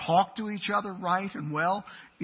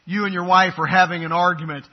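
usual speaking voice

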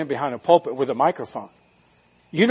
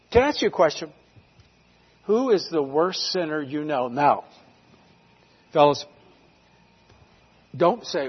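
ordinary delivery

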